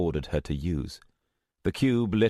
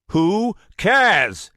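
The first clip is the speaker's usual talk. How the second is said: much louder, higher angry brush-off; angry shout; 14 semitones higher, 9 dB louder than usual. Acted. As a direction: bark it, losing patience. Anger mounting.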